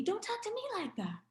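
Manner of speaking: lightly imitating the young man parroting her; mocking young man. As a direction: throw her words back at her, mocking. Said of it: "then he said to me".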